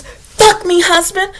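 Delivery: mock movie wife. Mocking how movie couples act, mild seductive tone. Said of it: "You see it in the movies".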